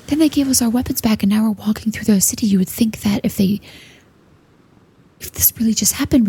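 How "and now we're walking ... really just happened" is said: hushed unvoiced whisper; stealth whisper. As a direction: whisper it so the guards miss it. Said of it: "stealth whisper".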